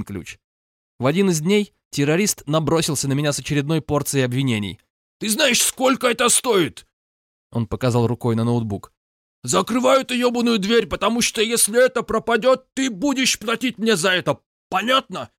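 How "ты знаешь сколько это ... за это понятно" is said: angry Caucasian roommate. A narrator's reading. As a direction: rage at him over the laptop. Roommate angrily accusing narrator. (гневный упрёк с акцентом)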